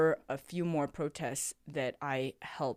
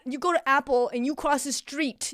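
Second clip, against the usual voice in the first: put-on high, loud call across the street to the kids; yelling at students; 8 semitones higher, 7 dB louder than usual. Teacher yelling to her students not to jaywalk, loud and high. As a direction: shout a warning like a protective mama. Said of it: "I yelled at them".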